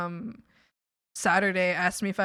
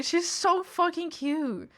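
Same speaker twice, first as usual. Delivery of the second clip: gushing; 7 semitones higher, 5 dB quieter, much slower than usual. Real, not acted. Gushing over a cute coworker.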